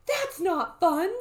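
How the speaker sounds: high, loud and slow-paced complaint; child's protest. Acted. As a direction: voice Annie's indignant objection. Annie protests in the story.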